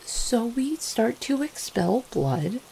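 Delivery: slow, high, tentative delivery; reluctant admission. Acted. Vampire breaking bad news gently to beloved, reluctant and tentative.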